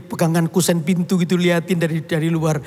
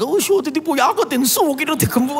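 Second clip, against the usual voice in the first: higher-pitched mother scolding in her own tongue; mother in her language; 7 semitones higher than usual. Retold or imitated. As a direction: rattle it off like the angry old mother. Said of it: "Pakai bahasa mereka". (ibu memarahi dalam bahasa daerah, suara lebih tinggi)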